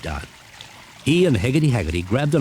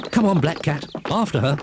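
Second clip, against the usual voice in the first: higher, eager chase call; urging witch; 8 semitones higher than usual. Acted. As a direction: hurry the cat after her.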